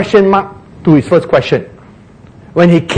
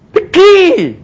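high, loud mock exclamation; hammed-up discovery; sudden realisation; 13 semitones higher, 5 dB louder than usual. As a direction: burst out as it dawns on you.

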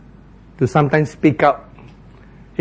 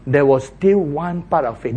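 ordinary talk

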